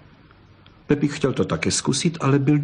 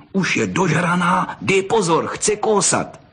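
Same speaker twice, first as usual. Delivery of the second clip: put-on high, urgent boy's alarm; excited warning; 6 semitones higher than usual. A narrator's reading. Boy warns his friend the fox will bite, excited. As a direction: warn your mate in a rising, high voice.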